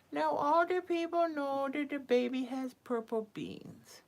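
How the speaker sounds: ordinary delivery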